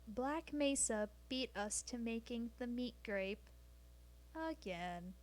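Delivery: barely-there deadpan computer voice; GLaDOS voice. Acted. Streamer telling chat the news as a light GLaDOS impression. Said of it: "hold on, GLaDOS voice".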